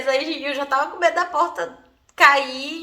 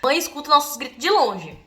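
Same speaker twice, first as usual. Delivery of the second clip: ordinary delivery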